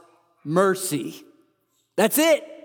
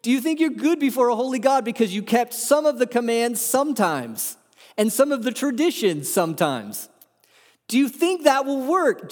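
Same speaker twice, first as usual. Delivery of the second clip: ordinary talk, nothing marked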